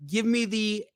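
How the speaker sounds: put-on "Snickers" said slowly as "es-NEE-kee-des" in a Mexican accent; tentative, drawn-out attempt at a Mexican accent; Mexican pronunciation. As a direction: imitate a customer trying a Spanish accent on "Snickers". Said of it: "how are they pronouncing this".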